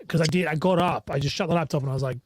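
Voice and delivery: ordinary talk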